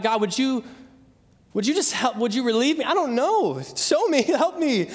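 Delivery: re-enacted desperate prayer, rising in pitch; crying out. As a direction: beg for help, with a laugh breaking in near the end. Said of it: "I'm crying out to God".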